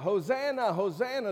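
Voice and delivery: high, loud joyful call; crying out praise. Retold or imitated. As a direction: cry it out like a cheering crowd. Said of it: "they're crying out".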